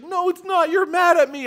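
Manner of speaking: put-on high, loud little girl wailing; high wailing child insisting he is angry; high crying child. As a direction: wail it like an upset four-year-old. Crying daughter voiced high.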